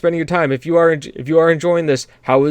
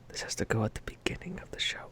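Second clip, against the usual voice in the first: whisper; 4 semitones lower, 17 dB quieter than usual. Real, not acted. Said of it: "I remember James put this".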